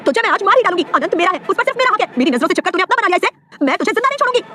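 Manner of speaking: high-pitched, frenzied threats; jealous rage climbing in pitch; murderous yell. A narrator's reading. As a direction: scream at your rival to claim him. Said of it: "Gautami चिल्लाई".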